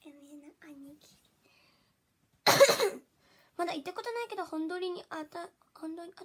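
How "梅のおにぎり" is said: 梅のおにぎり breathed in a soft whisper. Shown as a demonstration. A secret whisper of the rice ball line to viewers. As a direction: whisper 梅のおにぎり close to the mic.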